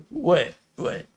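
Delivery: confused. Retold or imitated. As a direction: imitate a comic puzzled by the laughter. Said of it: "and he's confused".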